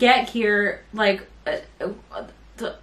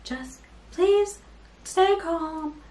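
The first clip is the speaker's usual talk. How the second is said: softer, soothing official voice; mock calm; 5 semitones higher, 3 dB quieter than usual. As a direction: imitate his calming customer-service tone. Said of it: "like, so calm".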